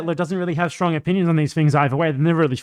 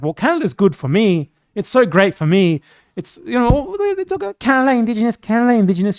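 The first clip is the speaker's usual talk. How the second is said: higher, sneering mock voice of a clueless settler; mocking imitation of her; 5 semitones higher, 4 dB louder than usual. Streamer mocks a critic's own words, higher sneering imitation. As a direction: mimic her as smug and oblivious, pitched up. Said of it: "she was like".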